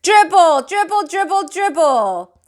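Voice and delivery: loud high chant; kids shouting. A narrator's reading. Kids chanting the name together, loud high shout. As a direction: chant along like little kids. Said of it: "started to shout too".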